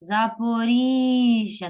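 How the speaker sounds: Запоріжжя with a very long і; stress on І. Shown as a demonstration. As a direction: call out Запоріжжя slowly, stretching рі. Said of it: "наголос падає знову на І".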